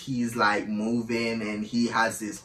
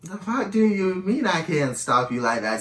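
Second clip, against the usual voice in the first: higher-pitched retold retort; incredulous; 7 semitones higher than usual. Woman retelling her retort to her partner, incredulous. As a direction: question it in indignant disbelief.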